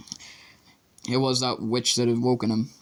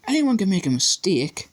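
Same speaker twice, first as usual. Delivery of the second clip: higher, firm schoolgirl voice; defensive girl; 8 semitones higher than usual. A narrator's reading. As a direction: defend the teacher primly.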